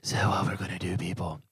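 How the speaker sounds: low, winded mock groan; mock short-of-breath delivery; acting out of breath. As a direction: play at wheezing through an announcement without an inhaler. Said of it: "I left my new inhaler".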